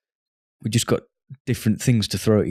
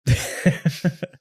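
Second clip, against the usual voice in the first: high laugh; 8 semitones higher than usual. Real, not acted. Laughing at friend's pizza.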